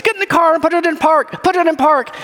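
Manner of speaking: high, quick, urgent order; mock yelling. Man to woman running up to the rolling Jeep, high urgent mock yell. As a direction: imitate a man calling out frantic instructions. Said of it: "then he starts yelling".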